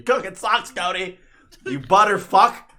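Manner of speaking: high, loud playful insult; loud teasing. Real, not acted. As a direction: yell a silly insult at a friend.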